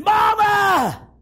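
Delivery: high, long, desperate shouts; screaming for parents. Acted. Child running through the house, screaming for parents. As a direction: scream each name high, drawn out and falling.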